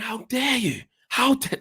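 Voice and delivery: high, whispered-then-rising outrage; incredulous outburst. Retold or imitated. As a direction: hiss it in disbelief like a stunned fan. Retelling his reaction in stand.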